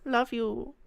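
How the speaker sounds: high British character voice; British accent. Acted. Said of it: "add a British accent to it".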